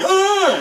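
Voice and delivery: a high, loud retold yelp, laughing; mock yelp of pain. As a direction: yelp as if suddenly bitten, half laughing. Dog bites him at event.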